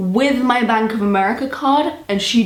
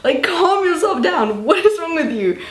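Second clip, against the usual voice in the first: higher-pitched, indignant telling-off; exasperated retort; 8 semitones higher than usual. Retold or imitated. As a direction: tell off someone who is overreacting. Retort to the ranting employee.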